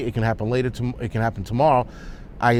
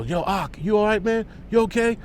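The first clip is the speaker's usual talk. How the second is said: imitating a bystander calling out, pitched much higher; worried call; 8 semitones higher than usual. Bystander calling to the shot clerk, voice pitched up in alarm. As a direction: anxiously check on a friend who may be shot. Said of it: "you hear one guy go".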